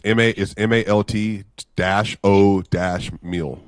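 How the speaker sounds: spelling it out slowly. Shown as a demonstration. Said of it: "How you spell that".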